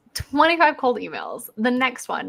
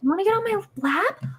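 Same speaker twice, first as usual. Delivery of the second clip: talking to the cat; 10 semitones higher than usual. Real, not acted. Coaxing the cat onto lap.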